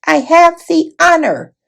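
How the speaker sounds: a tiny brave mouse calling out in greeting; shrill mouse voice. A narrator's reading. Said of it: "came his shrill voice".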